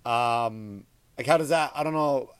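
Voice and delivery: ordinary talk, nothing marked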